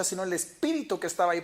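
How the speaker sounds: ordinary talk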